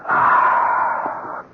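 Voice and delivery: a sharp breathy cry of dismay. Acted. Cop hearing his friend will testify against him, crying out in dismay. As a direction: let out a pained, stunned "ah".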